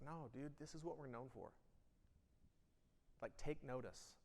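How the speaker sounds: firm declarative statement; plain firm declaration; bold, sure of it. Shown as a demonstration. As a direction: say it like a leader who believes it. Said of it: "It's like".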